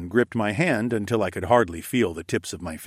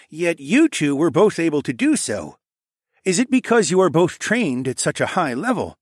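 higher, louder, probing voice; questioning king; 9 semitones higher, 6 dB louder than usual. Acted. King puzzles over visitors' magic, probing. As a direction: press both visitors for the reason.

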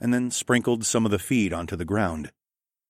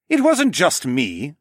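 higher, louder, drawn-out protest; defensive gasp; 7 semitones higher, 5 dB louder, slower than usual. A narrator's reading. Falcon man defending himself with a gasped, drawn-out protest. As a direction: protest it as if caught out. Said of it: "the Falcon man gasped".

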